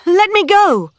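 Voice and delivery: high, loud cry of alarm. A narrator's reading. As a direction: cry out to push him off. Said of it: "she cried".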